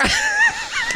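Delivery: high squealing burst of laughter; shrieking laugh. Real, not acted. Cohost howling at the Tic Tac jab. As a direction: scream with laughter.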